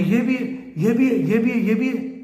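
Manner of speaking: high-pitched, imagined shock at each discovery; shocked disbelief. Pretend. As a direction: act out stunned repetition, pitched well up. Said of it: "देख के shocked हो जाएगी". (हैरानी भरी ऊँची आवाज़ में)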